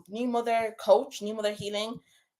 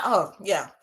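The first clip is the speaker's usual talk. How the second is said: normal talking voice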